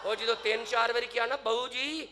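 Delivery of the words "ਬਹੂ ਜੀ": louder, higher call to the boss; insistent calling. Acted. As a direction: call out to rouse him.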